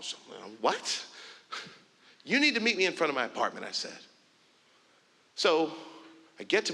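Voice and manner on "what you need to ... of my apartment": angry retort. Retold or imitated. Angry reply to neighbour.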